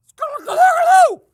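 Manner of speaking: sudden high, garbled shriek; loud nonsense howl. Pretend. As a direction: let out a wild, very loud yowl. Punchline to the dog-name joke.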